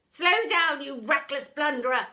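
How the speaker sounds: loud barked order; shouting teacher. Acted. Miss Seeth blocking pupil in corridor, shouting. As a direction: stop them dead with it. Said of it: "she would say".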